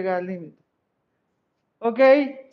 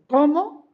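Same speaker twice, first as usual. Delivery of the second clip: "cómo" pitched up high and stressed; emphatic cómo; 6 semitones higher, 3 dB louder than usual. Shown as a demonstration. Teacher demonstrates the stressed "cómo" to the class, emphatic. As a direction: hit "cómo" hard and high. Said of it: "cuando le damos ese énfasis de".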